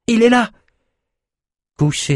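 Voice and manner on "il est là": high, loud cry of discovery; shouting. A narrator's reading. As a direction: call out that he's found him. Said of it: "s'écria".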